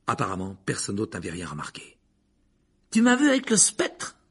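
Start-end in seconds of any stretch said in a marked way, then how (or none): 2.9-4.2 high, loud boast; excited cry. A narrator's reading. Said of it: "s'écria Simus". (exclamation forte et aiguë)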